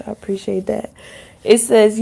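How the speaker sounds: usual speaking voice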